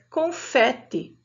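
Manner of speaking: confete whispered, with an open é in the middle. Shown as a demonstration. Teacher quietly modelling how confete is read. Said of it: "eu leia fé".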